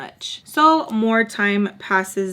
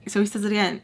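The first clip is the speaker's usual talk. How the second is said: ordinary talk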